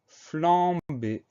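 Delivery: loud, high, stretched-out announcement; dictation word. Shown as a demonstration. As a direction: call out the word in long syllables. Teacher announces a new dictation word.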